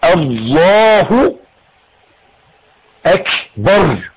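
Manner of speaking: الله and اكبر drawn apart very slowly; slow split takbir. Shown as a demonstration. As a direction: draw out each half, pausing between. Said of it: "تاني".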